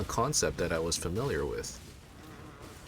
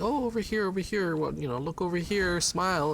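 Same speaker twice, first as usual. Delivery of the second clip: much higher, sing-song coaxing call; coaxing baby to smile; 11 semitones higher than usual. Retold or imitated. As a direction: coo at a baby to make her smile.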